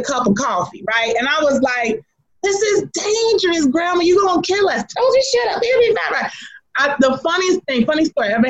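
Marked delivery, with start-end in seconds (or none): 2.4-4.8 scared grandchild. Retold or imitated. Grandchild in the front seat, pleading with grandma: high scared voice. 4.9-6.3 high, breezy grandma voice brushing off the fear; sassy grandma. Retold or imitated. Grandma at the wheel to her scared grandchild: high, breezy dismissal.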